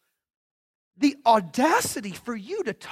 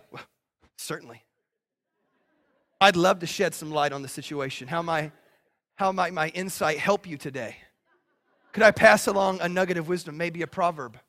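mock pompous sage; 9 semitones lower than usual. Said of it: "You're like".

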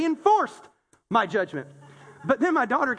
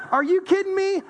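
incredulous; 8 semitones higher, 4 dB louder than usual. Retold or imitated. Mocking his old self.

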